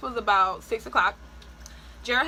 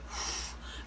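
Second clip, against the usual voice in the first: blowing out air; 13 dB quieter than usual. Shown as a demonstration. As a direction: demo of the wrong push, a long exhaled breath. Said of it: "you don't blow out air when you push".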